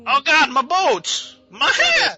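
high, drawn-out comic wailing; mock panic. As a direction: cry out over each loss in fake horror. Dubbing a panicked film character.

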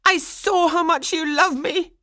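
very high, loud, upset cry; tearful outburst. A narrator's reading. Swanhilda answering Franz through tears, in a high outburst. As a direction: fling it back at top pitch, upset. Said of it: "She broke into weeping".

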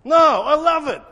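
high, booming exclamation; sudden emphatic outburst, pitched well up; loud approval. Real, not acted. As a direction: burst out with hearty approval. Pastor bursts out approving of heckling.

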